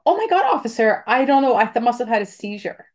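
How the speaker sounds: high, breathless fake innocence to a cop; mock innocent driver. Pretending to the officer she didn't mean to hit him, high mock innocent. Imagined talk with police after hitting him.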